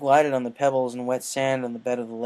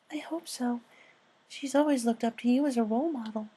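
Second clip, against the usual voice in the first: Fluttershy voice; 12 semitones higher than usual. Acted. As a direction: speak like a shy gentle mare.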